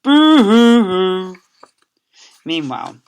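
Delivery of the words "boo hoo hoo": high, loud, slow boo-hoo-hoo; mother weeping comically; comic bawling; hammed-up bawling. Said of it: "She bawled throughout".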